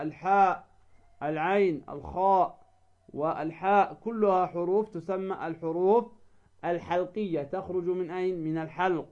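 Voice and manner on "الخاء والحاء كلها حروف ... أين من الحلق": ordinary talk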